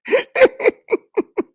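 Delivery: high squealing laugh. Real, not acted. Laughing at own joy.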